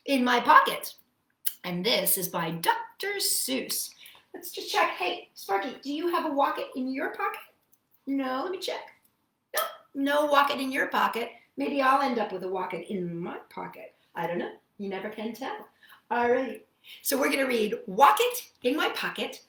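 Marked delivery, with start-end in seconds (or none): none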